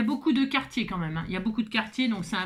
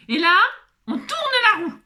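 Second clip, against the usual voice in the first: showman announcement; 10 semitones higher, 7 dB louder than usual. Pretend.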